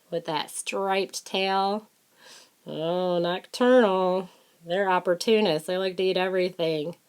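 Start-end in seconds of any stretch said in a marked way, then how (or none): none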